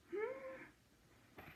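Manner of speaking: very high, very soft hmm; teasing question. Acted. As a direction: coax the kids to guess. Asks children what bear does.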